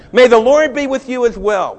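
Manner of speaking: loud, high answering call; hollering back. Retold or imitated. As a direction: boss returning a greeting across the fields. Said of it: "hollered back at them".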